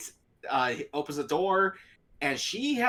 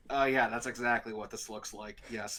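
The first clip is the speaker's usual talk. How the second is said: low, softer deadpan sarcasm; dry sarcasm, lower; sarcastic; 6 semitones lower, 5 dB quieter than usual. Retold or imitated. Steve answering the stranger, low deadpan sarcasm. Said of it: "And Steve's like".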